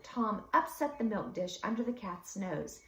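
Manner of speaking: ordinary delivery, nothing marked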